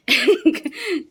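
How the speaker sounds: loud, high giggle; amused laugh. Real, not acted. Showing sunglasses to brothers-in-law, amused laugh. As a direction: giggle at your own showing off.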